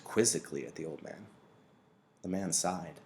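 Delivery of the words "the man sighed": ordinary delivery, nothing marked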